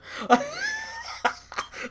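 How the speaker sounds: a sudden, squealing high laugh that bursts out; high burst of laughter. Real, not acted. Recapping the match, then he bursts out laughing. Cracking up at Heyman's face.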